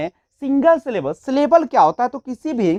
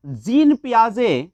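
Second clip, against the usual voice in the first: जीन पियाजे said slowly and carefully; teacher drawing out जीन पियाजे for the class; slow name; slower than usual. Shown as a demonstration. Teacher introducing जीन पियाजे to students, slow and careful. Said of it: "जीन पियाजे ने क्या कहा".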